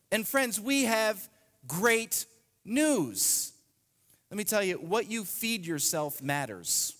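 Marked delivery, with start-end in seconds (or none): none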